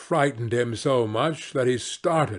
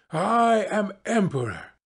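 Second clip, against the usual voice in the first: lightly higher, proud queenly tone; proud wife voice; 6 semitones higher than usual. A narrator's reading. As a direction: announce it like a haughty queen.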